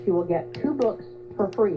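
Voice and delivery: normal talking voice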